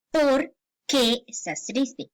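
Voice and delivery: POR... QUÉ as two slow words; slow, spaced-out "por qué" question; separated words. Shown as a demonstration. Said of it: "haya ese espacio y suene correctamente".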